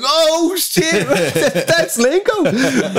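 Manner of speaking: mock shocked squeal, drawn out through laughs; shocked realisation. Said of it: "And he's like".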